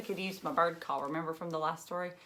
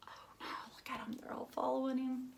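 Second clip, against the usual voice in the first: fast breathless whisper; hushed fast oh no; worried whisper; 6 semitones higher, 7 dB quieter than usual. Acted. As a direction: whisper it like a secret alarm to the kids. Worried aside about the chicks.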